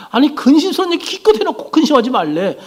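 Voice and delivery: high-pitched baffled objection; mock disbelief, pitched well up; incredulous protest. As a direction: protest as a bewildered disciple would. Acted disbelief, repeated protest.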